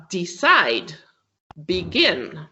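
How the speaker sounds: "decide, begin" said slowly, -CIDE and -GIN strong; stress on last syllable. Shown as a demonstration. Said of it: "if the stress is on the last syllable".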